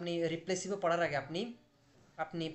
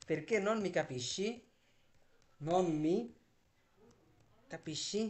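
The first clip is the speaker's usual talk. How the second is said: slow model sentence; much slower than usual. Shown as a demonstration.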